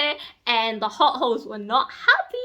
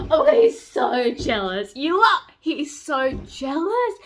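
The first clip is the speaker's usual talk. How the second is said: swooning; 4 semitones higher, 5 dB louder, slower than usual. Real, not acted. Swooning over jealous hero.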